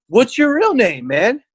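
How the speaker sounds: high-pitched, insistent questioning; mock interrogation. Friend interrupts the storyteller to ask who he really is, mock-demanding.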